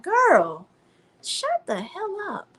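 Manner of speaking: slow exasperated put-down; sassy retort. Retold or imitated. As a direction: drag it out, fed up. Her retort to Amanda.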